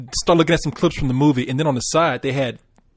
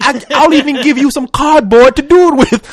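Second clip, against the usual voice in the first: mock commercial voice; 7 semitones higher, 5 dB louder than usual. Mimics the kid commercial pitch.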